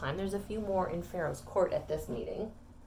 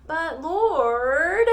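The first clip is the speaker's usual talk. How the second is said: high, loud, drawn-out protest; whiny objection; 7 semitones higher, 9 dB louder than usual. Acted. Moses objecting to the Lord's command, whiny protest. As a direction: protest to a higher power, pitched way up. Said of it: "Moses objected".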